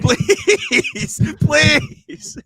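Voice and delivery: very high, loud drawn-out plea; mock begging. Host begging listeners for reviews, hammed-up high wail. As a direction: squeal it out like desperate begging.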